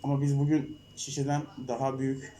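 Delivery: ordinary talk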